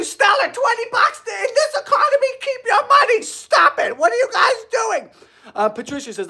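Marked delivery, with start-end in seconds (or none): 0.0-3.2 high excited squeal. Real, not acted. Excited reaction to donation. 3.2-5.1 high happy shout; excited shout. Real, not acted. Flustered at donation.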